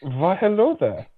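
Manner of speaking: a lightly voiced, welcoming innkeeper. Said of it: "So I've got to do an innkeeper voice".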